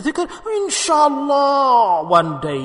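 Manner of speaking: slow, loud stretched inshallah; a loud, dragged-out mock promise; loud drawn-out inshallah. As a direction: bellow the inshallah lazily. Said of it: "he says loudly".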